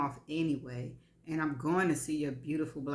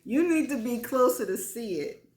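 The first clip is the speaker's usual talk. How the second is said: higher, louder playful come-on; flirty Stan; 7 semitones higher, 6 dB louder than usual. A narrator's reading. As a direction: invite her closer, teasing.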